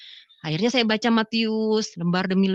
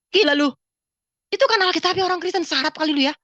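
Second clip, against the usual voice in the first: high-pitched scandalised objection; retold indignant protest; 7 semitones higher than usual. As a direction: act out scolding the voice, high.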